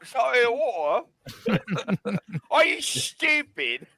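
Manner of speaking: mock outrage with laughter; mock British accent, laughing. Answering friend in mock British, laughing.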